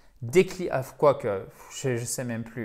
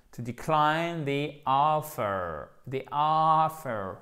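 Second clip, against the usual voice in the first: slow, long stressed O in "offer"; voyelle longue accentuée; slower than usual. Shown as a demonstration. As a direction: stretch the first vowel of "offer", "the" as "thee". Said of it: "accent tonique sur cette voyelle longue". (« offer » lent, première voyelle allongée)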